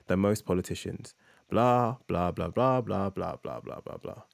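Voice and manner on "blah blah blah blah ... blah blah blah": bored blah-blahs trailing off into nothing; dismissive blah blah. Real, not acted. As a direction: wave the rest away with a fading blah blah. Skipping rest of read essay.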